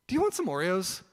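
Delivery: high, loud playful question; retold excited invitation; eager offer. Acted sudden offer in story.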